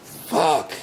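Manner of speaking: loud angry curse; angry loud swear. Real, not acted. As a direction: let it out at full force. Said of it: "Still mad about that".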